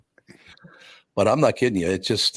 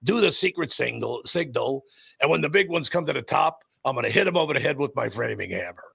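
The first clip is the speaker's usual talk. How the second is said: hammed-up wrestling promo voice, swooping higher on key words; Crusher; 4 semitones higher than usual. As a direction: boast like a cartoonish tough-guy wrestler. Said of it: "guys that talk like this".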